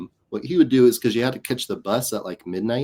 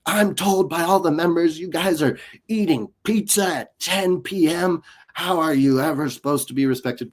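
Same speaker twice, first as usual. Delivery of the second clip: retold screaming; 7 semitones higher than usual. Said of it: "screaming at me".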